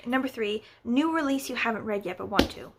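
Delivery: ordinary talk, nothing marked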